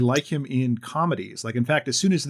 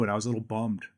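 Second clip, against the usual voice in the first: usual speaking voice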